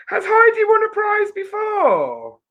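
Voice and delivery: very high, wailing question; mock suspicion. Host querying the winner to viewers, in high mock disbelief. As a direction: squeal it in exaggerated disbelief.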